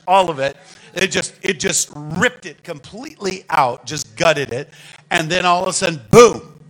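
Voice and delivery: fiery preaching. Real, not acted. Preacher warning the church it sold out, rising to a shout.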